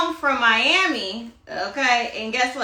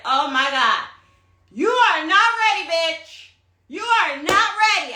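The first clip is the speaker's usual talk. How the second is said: high, dismayed self-scolding; retold exclamation; 5 semitones higher than usual. Runner out of breath telling herself off, high mock dismay. Retelling winded self-rebuke after running.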